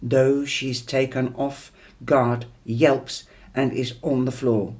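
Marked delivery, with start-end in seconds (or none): none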